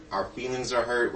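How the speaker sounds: ordinary talk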